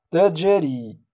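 "تجري" slow, with a bounced j; قلقلة صغرى. Shown as a demonstration. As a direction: release the j in "تجري" with a short echo. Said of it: "قلقلة الصغرى". (قلقلة الجيم في "تجري")

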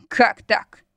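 slightly growled question. A narrator's reading. Husband challenging wife's complaint, mild growl. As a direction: growl it back at her. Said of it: "прорычал Дестон".

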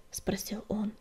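ordinary delivery

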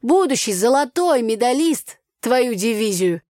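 put-on loud, high folksy village girl; brassy country girl scolding; Tanya's brash voice. Village girl Tanya, loud and brash.

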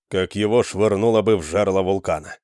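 ordinary delivery

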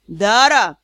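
loud sharp growl of a name; growled call. Acted. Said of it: "Рык лорда-директора".

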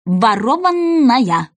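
drawn out, syllable by syllable; slow dictation. Acted. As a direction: spell it out as you write. Jurors write it down.

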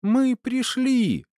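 high, slow squeal of delight; joyful cry. A narrator's reading. Little girl announces her arrival, joyful cry. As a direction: announce it in a very high, stretched-out cheer. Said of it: "радостный вопль".

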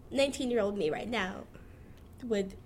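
ordinary talk

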